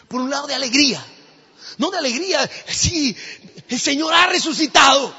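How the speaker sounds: loud, high burst of joy; joyful shout. Acted. Peter shouting for joy that the Lord rose. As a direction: cry out the good news with joy.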